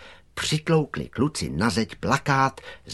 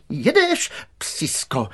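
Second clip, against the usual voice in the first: high, sharp order dropping on the second word; menacing roar; 6 semitones higher, slower than usual. A narrator's reading. Director roars at the dog below him, menacingly. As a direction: drive the dog off threateningly. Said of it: "ryczel zlověstně".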